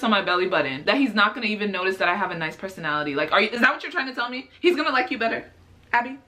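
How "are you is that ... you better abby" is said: normal talking voice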